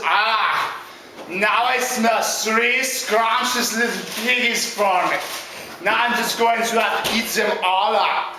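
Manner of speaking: slow, relishing villain voice; drawn-out storybook villain; hungry big bad wolf. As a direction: savour every word like a hungry wolf. Wolf smells three pigs.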